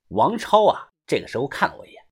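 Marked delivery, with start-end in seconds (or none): none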